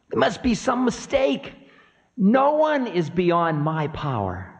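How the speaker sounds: high-pitched disbelieving protest; high, rattled figure of Time; Time, affronted. Acted. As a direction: insist on your power in a high voice.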